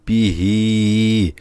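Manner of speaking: পিহি drawn out very slowly; stretched recitation. Shown as a demonstration. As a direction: hold the vowel long in পিহি. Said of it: "আমি বোঝানোর জন্য টান দিয়ে দিচ্ছি".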